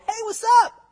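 hammed-up nonchalant hello; mock casual greeting. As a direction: play it breezy and careless. Mocking a casual greeting to angels.

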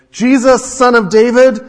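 blind man shouting loud and high for Jesus; crying out. A narrator's reading. Blind beggar calling out to passing Jesus, loud desperate cry. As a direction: call out over the crowd, loud and high. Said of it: "he began to cry out and say".

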